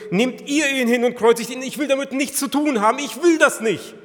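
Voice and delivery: loud, high, vehement refusal; impassioned preaching. Acted. Pilate refuses the priests' demand, vehement. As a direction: act out the refusal at full force.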